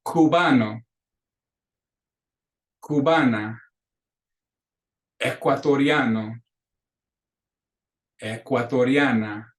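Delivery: slow, carefully drawn-out Spanish words; deliberate classroom pronunciation; repeat-after-me drill. Shown as a demonstration. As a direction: model each word for the class to copy. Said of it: "clases repitan".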